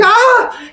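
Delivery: high, loud mock shriek; frightened scream. Woman as the box jumps, high frightened scream. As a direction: yelp in sudden fright.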